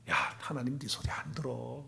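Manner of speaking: hushed, voiceless tempter's whisper; a tempting inner voice whispered; whispered taunt. Acted. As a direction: whisper it like a sly voice inside. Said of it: "그러면서 계속 속삭인단 말이에요".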